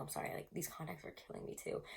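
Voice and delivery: soft breathy whisper, almost under the breath; hushed apology mid-reading; whispered aside. Real, not acted. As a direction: drop to a whisper for a quick aside. Aside about stinging contacts.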